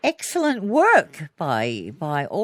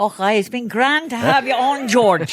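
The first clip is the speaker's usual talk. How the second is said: mock Scottish accent; 3 semitones higher, 4 dB louder than usual. Host bidding her Scottish guest goodbye, playfully imitating his Scottish accent. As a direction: sign off in a hammed-up Scots accent.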